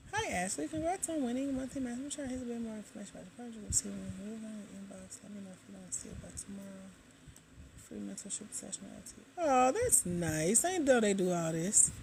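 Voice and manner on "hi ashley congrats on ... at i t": very soft, half-voiced reading; reading under breath. Real, not acted. Host skimming a message about winning monthly math, murmured reading. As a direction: murmur it to yourself as you read.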